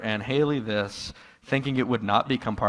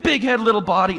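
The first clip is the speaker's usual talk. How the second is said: high, loud mock birth cry; mock mother at the birth; mother in labor; 10 semitones higher, 3 dB louder than usual. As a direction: ham up a mother naming her baby. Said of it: "His mother giving birth and going".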